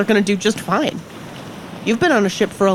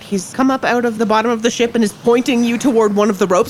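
ordinary talk, nothing marked

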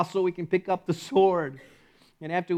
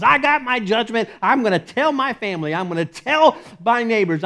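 loud, high-pitched, mounting tirade; self-righteous rant; 3 semitones higher, 5 dB louder than usual. Acted. Believer telling God he took up the sword, ranting. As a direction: declare it insistently, hammering each "I'm gonna".

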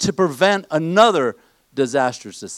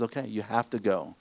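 low soft dismissal; 7 semitones lower, 11 dB quieter than usual. Retold or imitated. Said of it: "God says".

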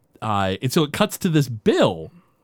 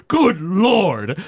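shocked exclamation; 5 semitones higher than usual. Retold or imitated. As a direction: gasp it out like a stunned old senator. Senator shocked on phone.

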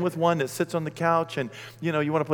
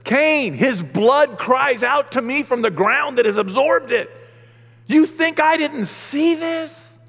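slow, high, loud stern voice of God; God's stern reply; 12 semitones higher, 8 dB louder, slower than usual. Acted. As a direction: pronounce judgment slowly and loudly. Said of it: "And God says".

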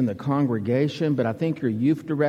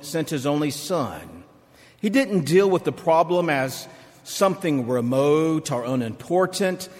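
measured pulpit reading, rising on key phrases; scripture reading. Acted. Preacher reading Romans to the congregation, measured pulpit read. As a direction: read the passage with weight and lift.

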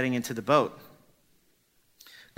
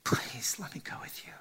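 softer whispered pleading; whispered begging; 6 dB quieter than usual. Acted. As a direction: beg quietly and urgently. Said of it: "And he's like".

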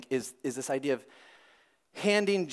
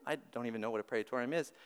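ordinary talk

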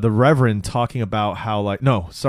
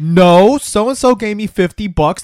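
mock defensive protest; 8 semitones higher, 5 dB louder than usual. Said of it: "He goes".

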